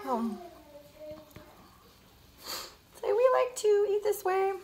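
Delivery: ordinary delivery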